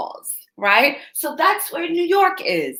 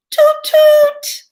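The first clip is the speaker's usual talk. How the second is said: much higher and louder, squeaky horn sound; cat toot toot; 7 dB louder than usual. Acted. Pete at the front of the cable car, high squeaky toot.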